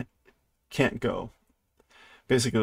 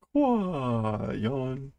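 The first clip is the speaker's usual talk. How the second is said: ordinary delivery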